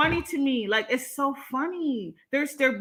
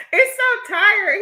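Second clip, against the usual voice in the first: exasperated; 8 semitones higher, 7 dB louder, much slower than usual. Real, not acted. Mocking a troll's insult.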